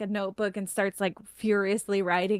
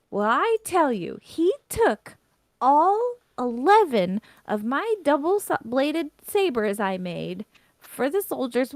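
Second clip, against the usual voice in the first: high-pitched, emphatic complaining; indignant tirade; 6 semitones higher, 5 dB louder than usual. Acted. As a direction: complain with pitch leaping on the grievance. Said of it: "a minute-long tirade complaining".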